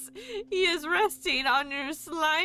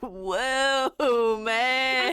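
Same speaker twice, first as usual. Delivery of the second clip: surfer voice; 4 dB louder than usual. Acted. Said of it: "surfer voice".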